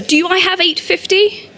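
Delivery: high, rising auctioneer's question, full of glee; gleeful auctioneer. A narrator's reading. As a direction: call for the next bid with relish. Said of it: "beside himself with glee".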